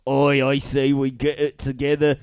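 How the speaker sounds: scheme like a Cockney crook; broad London Cockney accent. Shown as a demonstration. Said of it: "London Cockney".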